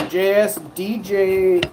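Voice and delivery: normal talking voice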